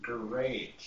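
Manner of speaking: usual speaking voice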